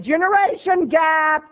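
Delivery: high slow pronouncement; martyred. Retold or imitated. Son ending the argument, high slow. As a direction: declare it with a sigh. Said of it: "I said".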